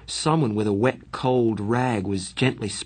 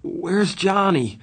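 higher, slow frightened question; frightened cry; 9 semitones higher, 3 dB louder, slower than usual. A narrator's reading. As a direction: call out scared for your friend. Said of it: "I cried".

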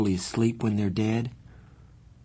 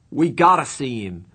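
loud, raised, insistent plea; shaken demand; 5 semitones higher, 7 dB louder than usual. A narrator's reading. Friend insisting to the doctor, shaken demand. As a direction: demand it with rising desperation. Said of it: "His voice was shaking".

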